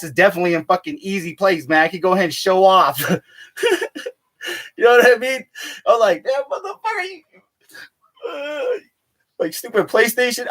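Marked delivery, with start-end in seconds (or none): 6.2-10.5 much higher, strangled mock rage; frustrated cursing. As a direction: swear at the broken console. Cursing the overheated PlayStation.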